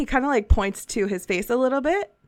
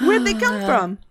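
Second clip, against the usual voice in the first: put-on Southern accent. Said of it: "It just continues to go Southern".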